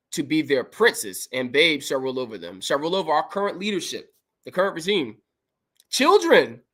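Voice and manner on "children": loud exclamation. Real, not acted. Emphatic cry in preaching.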